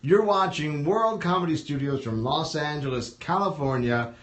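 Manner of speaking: big, drawn-out emcee delivery; show announcer. Real, not acted. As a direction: announce the act with a stretched-out name. Host announces act and show.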